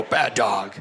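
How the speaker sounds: low-pitched scolding of a dog; scolding inflection. Shown as a demonstration. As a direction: demonstrate telling off a dog with a dropped pitch. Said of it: "Inflection in your voice".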